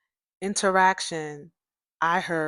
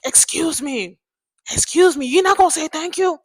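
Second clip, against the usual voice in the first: neighbor's indignant demand; 9 semitones higher, 7 dB louder than usual. Retold or imitated. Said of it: "I could hear her saying".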